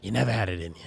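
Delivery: low, calm wise mentor; lightly voiced old sage. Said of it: "like an old sage".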